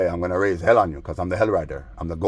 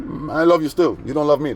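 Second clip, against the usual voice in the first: mock-sweet taunt; 7 semitones higher, 3 dB louder than usual.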